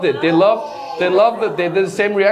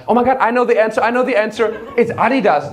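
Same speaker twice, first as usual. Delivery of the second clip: higher, eager know-it-all American; excited American; 6 semitones higher than usual. Acted. American crowd member answering a brand question, excited. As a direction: blurt it out like an overexcited contestant. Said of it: "Americans, they would have been like".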